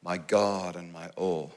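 soft, slow repeated prayer; soft prayer phrase. Shown as a demonstration. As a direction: murmur it slowly like a prayer. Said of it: "For Franciscans, they say".

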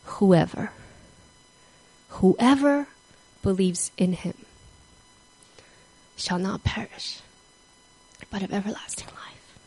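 fading down to a whisper; awed hush. Retold or imitated. Convict repeating the verse, fading to a whisper. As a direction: let it fade to a breath.

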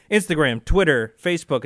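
loud, punchy list of sites. Real, not acted. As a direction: call out each site. Listing their social media loudly.

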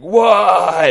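high, loud shriek to the sky; screaming shark thug; anguished scream. Acted. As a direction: scream it out in grief. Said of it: "Guppy screaming".

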